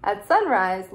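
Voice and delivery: ordinary delivery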